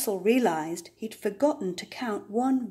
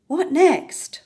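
higher, louder question; lively what-next question; eager; 7 semitones higher, 7 dB louder than usual. A narrator's reading. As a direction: bounce into what comes next. Narrator asks eagerly.

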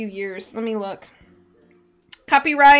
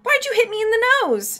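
very high, indignant retort; demanding back; 13 semitones higher, 3 dB louder than usual. A narrator's reading. J J to the woman who struck his nose, high indignant demand. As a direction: fire the question back, pitched way up. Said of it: "J J demanded back".

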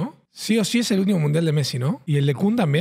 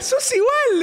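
mocking quote; slower than usual. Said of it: "le dice".

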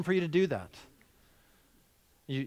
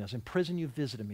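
ordinary talk

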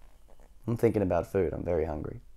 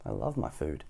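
ordinary delivery, nothing marked

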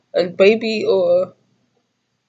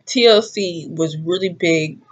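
usual speaking voice

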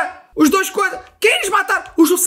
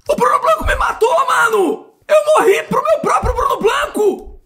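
high wailing half-sob shout; crying outrage; 11 semitones higher than usual. Real, not acted. Gamer killed by his own pet, high crying wail. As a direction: wail in comic despair.

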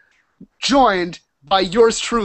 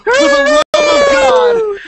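slow, high, loud exclamation; exasperated shout; 8 dB louder, slower than usual. Real, not acted. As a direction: shout it out in disbelief. Exasperated at six people joining.

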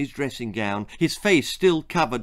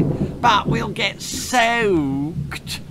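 very slow, high whine; whining; 7 semitones higher, much slower than usual. Acted. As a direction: stretch every word in complaint. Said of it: "whined Belinda".

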